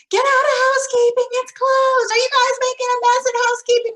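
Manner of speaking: high, loud scolding yell. Retold or imitated. Teacher yelling at kids in the play corner, high and loud. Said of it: "if you're yelling".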